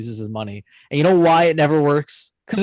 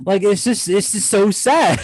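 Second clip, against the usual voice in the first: heartfelt lament; 8 semitones higher, 4 dB louder, slower than usual. Real, not acted. Moved by sad movie scene.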